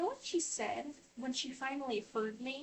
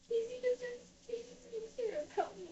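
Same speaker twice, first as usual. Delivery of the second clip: high-pitched, tearful complaint; retold crying, pitched way up; imitated tearful wail; 10 semitones higher than usual. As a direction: imitate yourself whining through tears. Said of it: "I was genuinely in tears".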